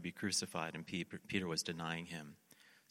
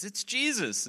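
high, loud cry of recognition; excited shout; 14 semitones higher, 8 dB louder, slower than usual. Retold or imitated. Somebody in the boat recognises Jesus, excited shout. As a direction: call out the news to the whole boat.

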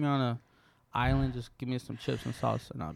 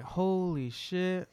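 slow amazed; 6 semitones higher, much slower than usual. Retold or imitated. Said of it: "I was like".

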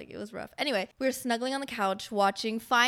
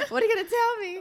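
high, anxious, laughing question; mock panic; 11 semitones higher, 4 dB louder, faster than usual. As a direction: squeak it out half-panicked. Panicking before I love you.

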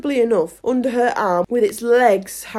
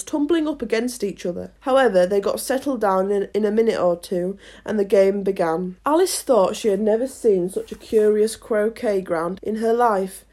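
usual speaking voice

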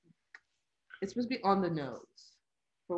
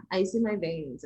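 bimbo voice; 3 semitones higher, 6 dB louder than usual. Acted. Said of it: "The bimbo voice?".

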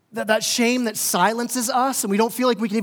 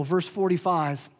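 ordinary delivery

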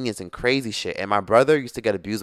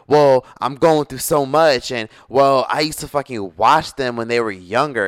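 louder, higher imitation of an aggrieved sister; mock complaining; 4 semitones higher, 6 dB louder, slower than usual. Sister holding her hardships over mom, mock complaining. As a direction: protest loudly, as if putting on her grievance.

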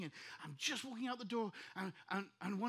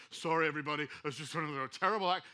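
loud, low-pitched blurting to a crowd; mock public announcement; 5 semitones lower, 8 dB louder than usual. Pastor imagining unloading on wedding guests, loud mock announcement. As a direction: boom out a mock apology.

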